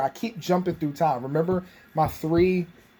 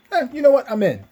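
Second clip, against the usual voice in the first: higher, louder offhand agreement; high casual Maze voice; 10 semitones higher, 5 dB louder than usual. Retold or imitated. Maze shrugs and agrees to join, higher and louder. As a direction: toss it off like it's nothing.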